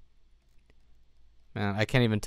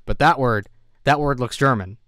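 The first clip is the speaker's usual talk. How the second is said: louder, higher, eager emphasis; eager, louder spotting of a German word; excited pointing out; 3 semitones higher, 10 dB louder than usual. Real, not acted.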